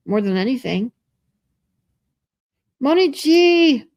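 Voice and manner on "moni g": high, loud drawn-out greeting; calling out. Real, not acted. Streamer hails a viewer arriving in chat, calling out. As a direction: sing out the name, stretched and high.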